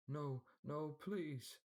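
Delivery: high, terrified begging; desperate pleading. A narrator's reading. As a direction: beg for life in terror. Servant begs before death.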